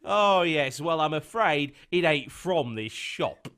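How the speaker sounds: a hammed-up Cockney shopkeeper; mock Cockney shop talk; Cockney-esque accent. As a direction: lay on the Cockney, slow and chummy. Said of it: "it was Cockney-esque".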